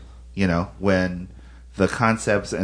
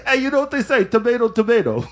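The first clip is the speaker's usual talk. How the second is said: New York pizza guy; 13 semitones higher than usual. Retold or imitated. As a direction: play the know-it-all pizzeria guy, pitched up.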